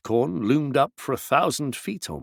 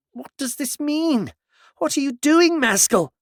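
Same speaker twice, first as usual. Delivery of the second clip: Tidomin, grabbed by Maskell, alarmed; alarmed woman; 13 semitones higher than usual. A narrator's reading. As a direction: cry out in sudden alarm. Tidomin startled after a scream.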